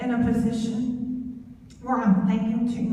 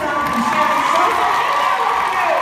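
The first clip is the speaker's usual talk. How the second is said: hyped introduction; 6 semitones higher, 7 dB louder than usual. Real, not acted. Introducing next speaker to crowd.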